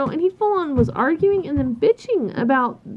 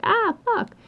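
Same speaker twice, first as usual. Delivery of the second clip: mock dismayed curse; 10 semitones higher than usual. Realizing the client wanted something else.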